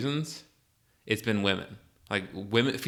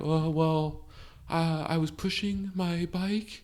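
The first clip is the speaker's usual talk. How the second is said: high, slow, halting testimony; imitated nervous teen; 7 semitones higher, 4 dB quieter, slower than usual. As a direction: play a timid kid addressing the judge. Acting nervous teen before judge.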